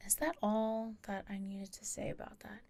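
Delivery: whispering a question to herself, off mic; a quick hushed check under her breath; whispered aside. Real, not acted. Storyteller whispers to herself mid-story, a hushed aside.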